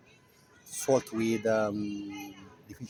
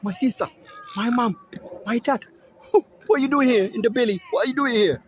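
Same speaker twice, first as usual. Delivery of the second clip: louder and higher, laughing through the astonished question; amazed boy; 9 semitones higher, 7 dB louder than usual. Retold or imitated. Boy finds his family partying in the lion's belly, amazed and laughing. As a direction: call out in disbelief to the family. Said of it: "he said".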